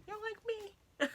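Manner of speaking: hammed-up offence on "You don't like me?"; a squeaky, mock-wounded "You don't LIKE me?"; mock offended voice. Playful intro skit, repeated line.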